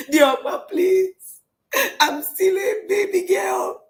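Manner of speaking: high, loud mock wail; hammed-up crying plea; acted tearful pleading. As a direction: beg shrilly like a girl being sent away. Acting Hagar begging as sent off.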